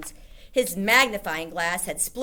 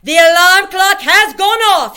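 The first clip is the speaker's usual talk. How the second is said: very high, very loud witch shriek; 13 semitones higher, 18 dB louder than usual. A narrator's reading. Grand High Witch shrieking as the formula starts. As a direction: shriek it in triumph. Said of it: "shrieked the Grand High Witch".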